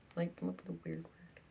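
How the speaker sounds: soft unvoiced whisper. Real, not acted. To the camera, a whispered aside. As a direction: murmur it under your breath.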